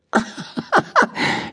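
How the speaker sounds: put-on short airy boy's laugh; a quick breathy chuckle. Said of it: "Benny lachte".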